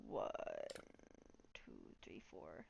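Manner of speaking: counting under the breath; whispered counting. Real, not acted.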